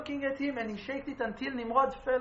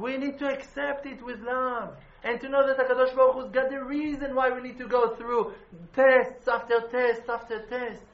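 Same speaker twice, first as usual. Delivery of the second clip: preaching; 7 dB louder than usual. Acted. Preaching the need for tests.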